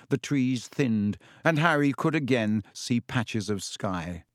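plain reading voice

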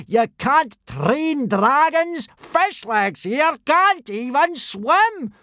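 angry roar. Acted. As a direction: tear into the boys. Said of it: "roared Gobber".

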